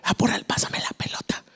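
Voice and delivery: playful whisper; barely voiced, hushed request; children's whispered talk. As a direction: whisper like kids playing in secret. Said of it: "hablábamos así".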